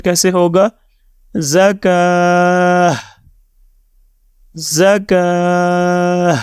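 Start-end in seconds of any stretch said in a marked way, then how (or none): 1.3-6.3 زکاہ drawn out very slowly, ending in breathy h; final ha sounded. Shown as a demonstration. Teacher shows learners a pause, زکاہ ending on ha instead of ta. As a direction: stretch زکاہ and close it on an h. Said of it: "اب ہم صرف ہا کی آواز نکالیں گے".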